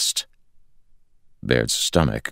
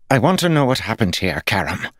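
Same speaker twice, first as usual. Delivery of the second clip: higher, fast angry snap; 8 semitones higher, 3 dB louder, faster than usual. A narrator's reading. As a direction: bite it off at Karam. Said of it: "Lord Jared snapped".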